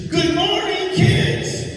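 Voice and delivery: high sing-song mock greeting; cheery host mother. Comic recalls the host lady greeting kids in a high cheery voice. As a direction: chirp it like an overly cheery morning lady. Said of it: "she goes".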